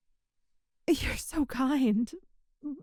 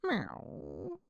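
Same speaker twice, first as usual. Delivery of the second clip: cat meow; 10 semitones lower, 11 dB quieter than usual. Acted. Catgirl meowing between lines.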